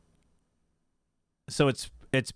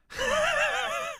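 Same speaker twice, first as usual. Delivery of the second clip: high laugh. Real, not acted. Laughs at streamer's skill.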